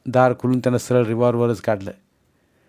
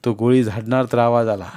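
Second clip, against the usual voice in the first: ordinary delivery, nothing marked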